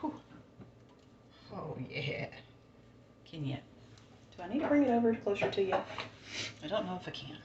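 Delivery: normal talking voice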